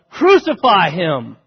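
slow, loud mob yell of "Crucify him"; "Crucify" drawn out and shouted by the mob; crowd yell. Retold or imitated. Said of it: "And their yell was".

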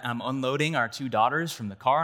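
ordinary delivery

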